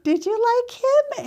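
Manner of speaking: high, playful did you like him; teasing disbelief. Retold or imitated. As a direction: tease her in surprise. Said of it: "I look over at her and I'm like".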